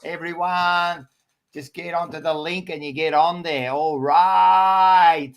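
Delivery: hyped host shout-out to viewers; channel plug. Acted. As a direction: sell the subscribe like an ad read.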